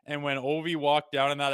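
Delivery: normal talking voice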